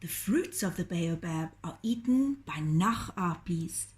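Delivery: plain reading voice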